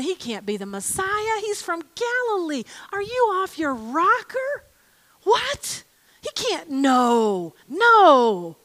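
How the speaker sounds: mock dismissive scoffing; scoffing skeptics. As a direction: brush it off in disbelief. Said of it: "And others were going".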